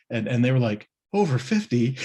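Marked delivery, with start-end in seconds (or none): 1.1-2.0 a high, disbelieving laugh; incredulous laugh. Retold or imitated. Young riders laughing high in disbelief at over fifty. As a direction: laugh off the claim, squeaky.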